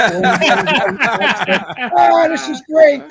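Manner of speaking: hammed-up rookie joy shout; mock delight.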